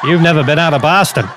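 mock the film's Boston accent; bad Boston accent. Guest quoting the film to his co-host, bad Boston accent. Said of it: "the late, great Robin Williams".

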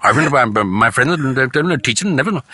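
imitating a brother's rapid-fire protest; speaking too fast. Brother denying to father he talks fast, speaking too fast. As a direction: rattle off a defensive denial, words tumbling. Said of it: "even that you're speaking fast".

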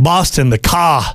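lightly put-on Boston accent; Boston accent, R softened away. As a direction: try a Boston accent with dropped R's.

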